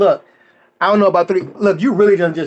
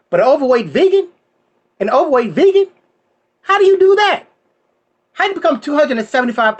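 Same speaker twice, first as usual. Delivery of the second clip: high, rising disbelief at each question; mock shocked comedian; incredulous; 9 semitones higher than usual. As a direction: push the outrage up each time. Comic disbelief at vegan.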